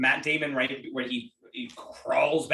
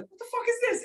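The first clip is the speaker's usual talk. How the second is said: much higher, incredulous mock bartender; mock outraged bartender; faster than usual. Quoting the bartender to podcast friends, mock incredulous. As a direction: play the baffled bartender, squeaky high.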